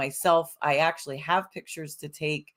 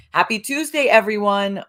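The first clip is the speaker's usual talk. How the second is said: excited; 4 semitones higher, 6 dB louder than usual. Real, not acted. Upbeat greeting to the viewers.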